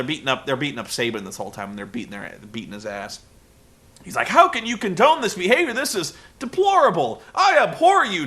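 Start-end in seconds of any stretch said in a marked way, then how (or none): 4.3-8.3 high, loud prissy indignation; shrill mock scolding of the partner; hammed-up outrage. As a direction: play the scandalised commentator big. Tenay scolds Taz, escalating.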